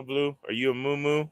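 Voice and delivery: ordinary talk, nothing marked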